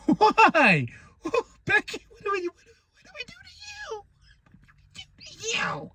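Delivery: high, squeaky, fading whine; mock whining plea. New Mexican fan asks Becky what they did, in a high comic whine.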